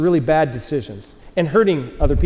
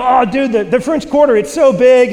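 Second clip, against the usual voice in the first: higher, louder, gushing; imitating excited teen; 7 semitones higher, 5 dB louder than usual. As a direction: gush like a wide-eyed kid. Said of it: "She's telling people".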